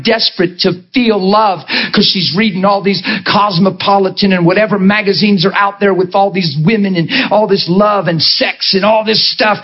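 ordinary talk, nothing marked

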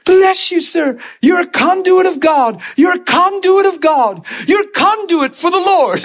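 high-pitched, overwrought mock yell; imitated yelling. Imitating healed man in his chair, high yelling. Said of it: "He's yelling".

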